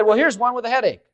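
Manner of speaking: a hint of a slick faith healer brushing off a headache; mock faith healer. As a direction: toss off a blessing like a quick-fix healer. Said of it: "He should have said".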